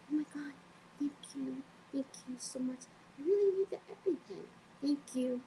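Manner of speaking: high, soft gushing gratitude; overly grateful, hammed-up; mock gushing at the clerk, high and soft; gushing thanks. Gushing thanks over EpiPen.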